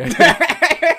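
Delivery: laughing through the words; cracking up mid-sentence. Real, not acted. Laughing while speaking.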